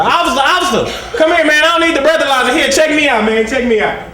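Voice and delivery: high, insistent appeal; urgent calling out. Acted. Pulled-over driver begging off the breathalyzer, high urgent call. Acted nervous plea to the officer.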